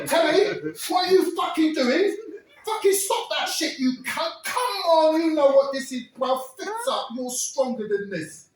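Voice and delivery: high, loud, urgent yelling; loud pleading yell. Retold or imitated. Ez yells at his cellmate to stop, high and loud. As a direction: yell at him to snap out of it.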